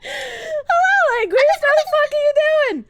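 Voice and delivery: high, wavering sing-song vibrato; full vibrato. Real, not acted. Streamer greeting a chatter, high wavering vibrato. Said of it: "your voice was going full vibrato".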